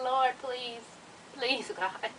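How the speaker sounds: hushed, mock-desperate plea; pleading prayer. She begs God over the man's antics, hushed plea.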